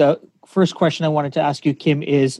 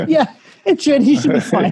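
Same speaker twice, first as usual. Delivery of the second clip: laughing; 9 semitones higher than usual. Real, not acted. Retelling doctor, laughing.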